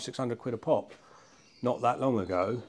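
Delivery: normal talking voice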